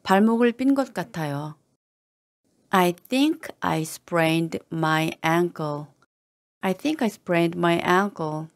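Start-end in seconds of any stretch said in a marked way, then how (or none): 2.7-5.9 slow, careful and distinct; slow model reading. Shown as a demonstration. Teacher modelling the phrase for learners, slow and careful. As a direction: take it slowly so they can copy. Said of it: "teacher models slow first reading".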